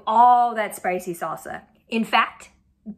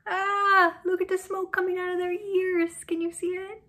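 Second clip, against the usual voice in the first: amazed; 8 semitones higher, 4 dB quieter than usual. Acted. Reading picture book to children.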